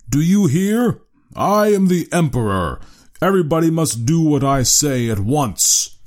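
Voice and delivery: loud, high imperial command; commanding cry. A narrator's reading. Harrison commanding the cowering studio, loud. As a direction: demand obedience at full voice. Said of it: "cried Harrison".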